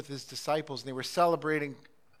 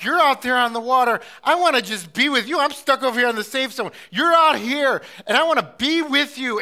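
loud, higher voice of Peter longing to join Jesus; eager plea; 7 semitones higher, 11 dB louder than usual. Acted. Peter calling out to Jesus on the water, loud and longing. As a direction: call across the water, loud and yearning. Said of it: "He was like".

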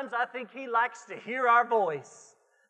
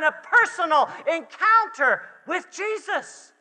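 loud, raised, urgent exhortation; full-force preaching; 5 semitones higher, 6 dB louder than usual. Real, not acted. Preacher urging people in this room, full-force preaching. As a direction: build each phrase towards a forceful peak.

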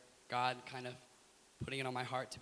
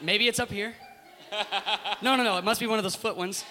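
higher, louder baffled guesswork; puzzled guessing; 6 semitones higher, 9 dB louder than usual. Retold or imitated. Newcomer hunting for the door switch, comically puzzled. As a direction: act out a flustered newcomer puzzling aloud. Retells fumbling at the train door.

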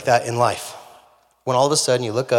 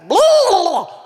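a sharp, much higher, loud gobble sound; high booming gobble imitation; 6 dB louder than usual. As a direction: boom out a mock turkey gobble.

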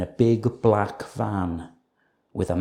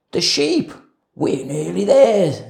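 thief voice; 7 semitones higher, 5 dB louder than usual. A narrator's reading. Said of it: "said Len".

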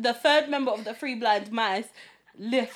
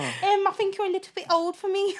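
high Scouse impression with laughter; mock Scouse brush-off, pitched high; strong Scouse accent; 8 semitones higher than usual. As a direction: imitate a Scouse girl, laughing through it. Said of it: "her accent was so strong".

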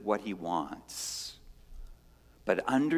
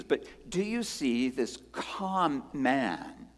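ordinary talk

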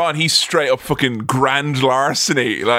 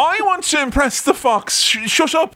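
high, over-keen pleading; hammed-up starstruck protest; mock eager admirer; 10 semitones higher than usual. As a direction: blurt it out high and flustered. Acting out eagerness to impress fox.

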